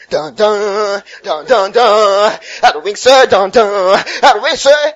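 loud, punchy imitated keyboard stabs between preacher's cries; mock church keyboard backing. Parodying a revival service with keyboard, loud mock hype. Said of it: "Then there's keyboard in the background".

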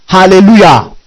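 usual speaking voice

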